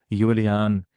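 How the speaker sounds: usual speaking voice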